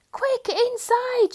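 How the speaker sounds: high, loud, drawn-out shout; urgent shout. A narrator's reading. Missus Thomas calling family in from the thunder, shouting. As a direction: hurry everyone in from the storm. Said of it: "shouted Missus Thomas".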